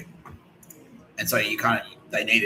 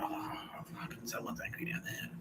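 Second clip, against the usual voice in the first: very soft, voiceless murmur; hushed reaction; 17 dB quieter than usual. Acted. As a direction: murmur it as if hearing far-off screams. Imagined hearers of distant psychic screams.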